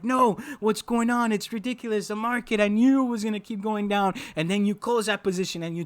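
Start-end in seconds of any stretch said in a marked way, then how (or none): 0.0-4.1 higher, exasperated trader whining at the market; mock disbelief. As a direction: act out the baffled trader, half-hearted outrage. Losing trader voiced in disbelief.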